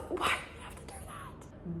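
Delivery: hushed, despairing whisper; despairing over the man, whispered. Real, not acted. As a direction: breathe it out in despair.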